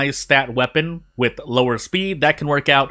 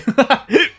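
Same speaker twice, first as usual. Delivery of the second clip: short laugh; 11 semitones higher, 3 dB louder than usual. Real, not acted. Laughs at a good luck roll.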